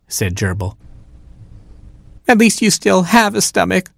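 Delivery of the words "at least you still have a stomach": high, tearful hamster wail. A narrator's reading. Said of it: "and cried all the harder".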